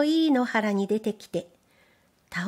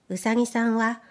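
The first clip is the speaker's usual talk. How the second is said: ordinary delivery, nothing marked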